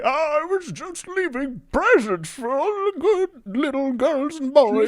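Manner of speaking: very high, slow sweet pleading; innocent Santa. Acted. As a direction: play the wounded innocent. Santa defends himself in court.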